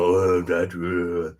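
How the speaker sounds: ordinary delivery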